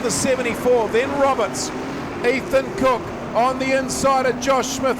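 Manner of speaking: normal talking voice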